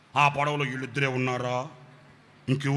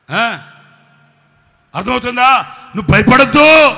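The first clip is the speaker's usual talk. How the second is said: raised, louder call to the crowd; 10 semitones higher, 19 dB louder than usual. Real, not acted. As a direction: call out to the congregation. Preacher calls out to congregation. (సభకు గట్టిగా పిలుపు)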